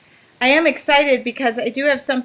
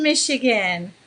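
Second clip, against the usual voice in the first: ordinary talk, nothing marked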